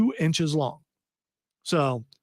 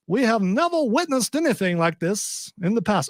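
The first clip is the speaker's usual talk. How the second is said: a Southern accent, raised and refined; genteel Southern accent; 4 semitones higher than usual. Shown as a demonstration. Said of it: "Should have read it in that accent".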